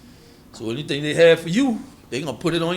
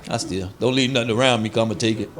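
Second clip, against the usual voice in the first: lower, half-laughing mock confession of a thief; 8 semitones lower, 4 dB quieter than usual. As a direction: play a shameless thief owning up, lower. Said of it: "Nobody gonna tell you".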